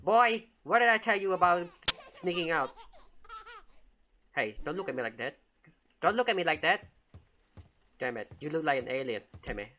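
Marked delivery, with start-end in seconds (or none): 0.0-2.7 high, loud parental telling-off; loud scolding. Pretend. Babysitter catching the baby sneaking out, scolding loudly. 6.0-6.9 higher, louder repeat warning; insist, voice raised at the child. Pretend. Babysitter warning the staring baby, voice raised.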